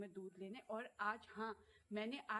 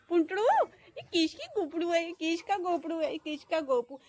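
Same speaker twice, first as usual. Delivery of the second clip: higher, louder cooing pet names; 8 semitones higher, 13 dB louder than usual. Real, not acted. Cooing pet names at her dog, high and loud. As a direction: coo lovingly at the dog. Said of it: "प्यार से बोल रहे हैं".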